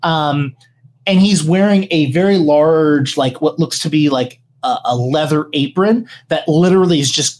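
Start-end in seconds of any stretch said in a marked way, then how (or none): none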